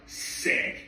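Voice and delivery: voiceless, awed mock whisper; impressed whisper. Singer seeing a fan's tattoo, impressed whisper.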